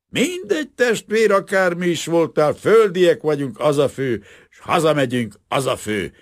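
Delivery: booze-happy, sloppy cheer; drunk worker. A narrator's reading. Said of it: "a részegek bambaságával". (részeg, hangos, vidám ácslegény)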